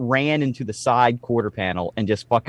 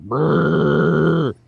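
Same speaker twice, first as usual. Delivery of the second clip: rolled-lip brrr of a shaking animal; imitated shudder noise; 4 semitones higher, 4 dB louder than usual. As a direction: buzz your lips like a deer shaking off. Deer shaking itself off.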